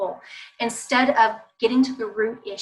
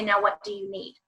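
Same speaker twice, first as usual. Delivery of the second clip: put-on softer, lower calm ask to the child; gentle question; 4 semitones lower, 6 dB quieter than usual. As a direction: turn to him kindly and ask. Calm turn to child after waiting.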